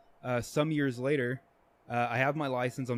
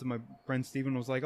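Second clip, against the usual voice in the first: usual speaking voice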